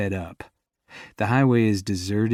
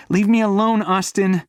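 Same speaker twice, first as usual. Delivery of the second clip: much higher, louder shout; shout over shoulder; 11 semitones higher, 6 dB louder than usual. Acted. Walking away from her boyfriend, shouting back. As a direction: throw it back without turning. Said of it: "she shouts over her shoulder".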